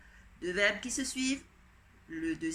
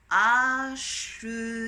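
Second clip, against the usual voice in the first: Acheter said slowly and louder, syllables stretched; drawn-out dictation; 2 semitones higher, 9 dB louder, much slower than usual. Shown as a demonstration. Teacher dictating the next word, slow and loud. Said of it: "dictation word drawn out".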